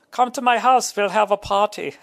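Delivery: high, loud goofy party-guy voice; high goofy heckler voice; imitating a crowd guy. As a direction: call out like an eager drunk fan. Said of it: "That's what it fucking sounded like".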